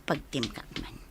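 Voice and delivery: soft whispered giggle then low words; whispered aside. Acted. Girl working up courage before greeting Ronnie, whispered. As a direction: hush it to yourself.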